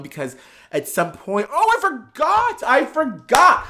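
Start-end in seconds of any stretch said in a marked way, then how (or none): 1.5-3.7 sudden recall. Real, not acted. Suddenly remembering a detail.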